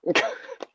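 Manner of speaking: squeaky high-pitched laugh; soft high squeal of laughter; high giggle. Real, not acted. Laughs at rock fight.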